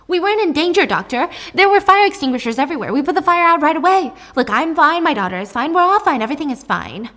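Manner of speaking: high, loud defensive protest; frantic insistence. Acted. Mom assures doctor there was no danger, frantic. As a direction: insist loudly, tumbling through the excuses.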